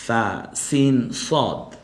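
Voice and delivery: সা, সিন, সদ drawn out very slowly; slow letter demo. Shown as a demonstration. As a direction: model সা, সিন, সদ slowly for students. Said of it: "বলেন". (ধীরে ধীরে সা, সিন, সদ)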